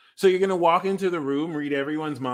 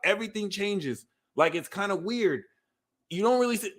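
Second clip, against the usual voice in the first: usual speaking voice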